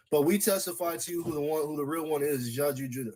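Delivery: normal talking voice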